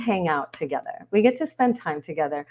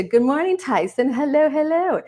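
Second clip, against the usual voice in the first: high, louder hello; excited greeting; 8 semitones higher, 4 dB louder than usual. Real, not acted. Host breaks off setup to greet Tyson, excited greeting. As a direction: call out brightly to someone just arriving. Calling out to an arriving participant.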